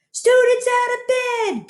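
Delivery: very high, slow bellowing alarm. Acted. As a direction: bellow the alarm for all to hear. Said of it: "Peeves bellowed".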